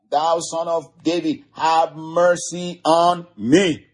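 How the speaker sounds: high, insistent plea, slowing at the end. Acted. Blind man cries out over the crowd, high insistent plea. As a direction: plead high, drawing out the last words. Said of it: "he cried out a great deal more".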